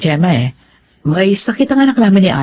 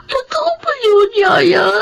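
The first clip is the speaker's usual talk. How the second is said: crying out; 11 semitones higher, slower than usual. Acted. Child crying to her nanny about her father's affair. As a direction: sob it out, pleading for the truth.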